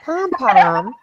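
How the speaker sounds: put-on saying pom-pom in a New Jersey accent; "Pom pom" with a round Jersey "o". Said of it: "I'll forgive you if you say the word pom-pom".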